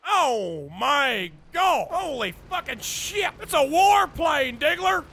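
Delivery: normal talking voice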